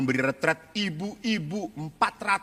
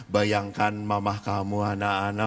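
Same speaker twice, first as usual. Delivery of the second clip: solemn guided imagining; 8 semitones lower than usual. Acted. Priest asking children to picture their mothers, solemn guided imagining. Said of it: "Lalu saya ngomong".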